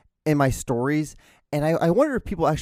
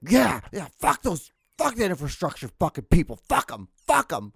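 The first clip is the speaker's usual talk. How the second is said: hammed-up excited mock rant; amped-up dog; 4 semitones higher than usual. Podcaster voices his dog joining in, amped up. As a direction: join the rant as a riled-up dog. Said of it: "she just gets amped up with it".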